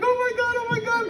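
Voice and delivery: high gushing oh my God; gushing girlfriends. Retold or imitated. Girlfriends reacting to the ring, high gushing voices. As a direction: coo it like thrilled friends. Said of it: "They're like".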